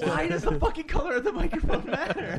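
high incredulous laughing rant. Real, not acted. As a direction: laugh out the complaint. Laughing complaint about gold mic.

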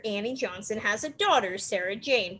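usual speaking voice

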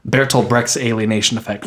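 ordinary talk, nothing marked

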